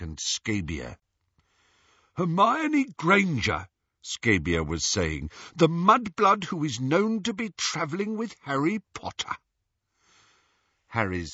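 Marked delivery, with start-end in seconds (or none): 2.2-3.7 high, pointed snatcher voice; Snatcher Scabior. A narrator's reading. Snatcher Scabior reveals the captive's name. 5.5-9.4 high, excited snatcher voice; Snatcher Scabior. A narrator's reading. Snatcher Scabior names the captive.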